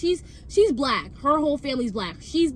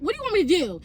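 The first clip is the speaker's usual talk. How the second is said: normal talking voice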